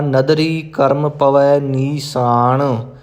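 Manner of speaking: ਨੀਸਾਨ said with a plain s, not sh. Shown as a demonstration. Teacher warns against ਨੀਸ਼ਾਨ, careful pronunciation. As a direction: say nee-saan, no sh sound. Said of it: "ਇਸ ਕਰਕੇ ਪਾਠ ਕਰਾਂਗੇ ਆਪਾਂ".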